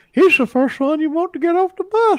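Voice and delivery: put-on high leering old man voice; creepy old man impression; Herbert the Pervert voice. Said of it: "in the voice of Herbert the Pervert".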